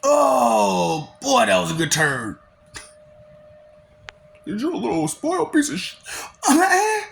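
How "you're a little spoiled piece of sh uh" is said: mock crying. Mock crying over a turn.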